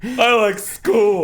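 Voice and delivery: put-on slow, dopey oversized kid; slow dumb kid voice. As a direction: talk like a big slow second grader. Playing a giant second grader.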